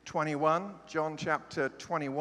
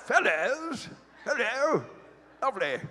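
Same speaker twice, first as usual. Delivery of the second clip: high, hammed-up British chap; cheery mock-British hailing, pitched way up; 9 semitones higher, 5 dB louder than usual. Preacher mocking the translation, high British chap. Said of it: "That sounds like a British chap".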